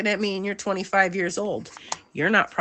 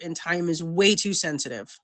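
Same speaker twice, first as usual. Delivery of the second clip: ordinary delivery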